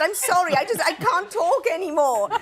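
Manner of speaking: comic frazzled apology; retold talked-out apology. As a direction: give up helplessly, laughter creeping in. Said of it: "lost the use of my head and the ability to speak".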